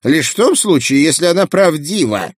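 high squawky pelican voice; old pelican voice. Acted. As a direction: squawk it like a grumpy old bird. Pelican retorts.

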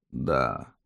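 faint low croak; weak dying voice. Acted. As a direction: answer like a dying man. Dying witness answers questions. (слабый голос умирающего)

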